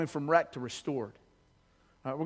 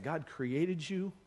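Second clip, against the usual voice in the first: usual speaking voice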